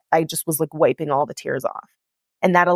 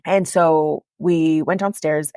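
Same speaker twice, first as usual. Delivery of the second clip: normal talking voice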